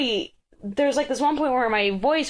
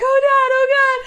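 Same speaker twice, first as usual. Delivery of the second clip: high, loud, panicky repeats; terrified whimper; 7 dB louder than usual. Retold or imitated. Girl steps onto the platform: high, frightened gasps. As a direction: breathe out the words in terror. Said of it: "She's like".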